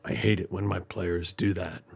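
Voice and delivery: sulking game master's quiet complaint; disgruntled DM. Acted. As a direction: grumble it under your breath, low and resentful. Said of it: "and D Ms responding".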